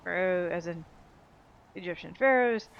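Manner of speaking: ordinary delivery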